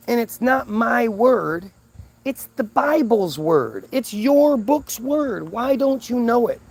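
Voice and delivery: high, drawn-out word-by-word insistence; exasperated lecturing. Retold or imitated. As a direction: hammer it home slowly, exasperated. Retold heated correction of her.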